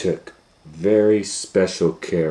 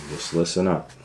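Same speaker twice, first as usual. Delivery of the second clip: ordinary delivery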